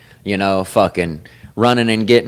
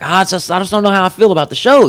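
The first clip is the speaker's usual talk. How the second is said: higher, fast, unsure friend voice; imitating a friend; 9 semitones higher, faster than usual. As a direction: rattle it off like a doubtful buddy. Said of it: "Dude was like".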